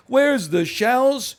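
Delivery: loud, high, very drawn-out question; mocking streamer impression. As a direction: stretch it into an exaggerated mocking imitation. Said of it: "Here's my impression of y-, you at the beach".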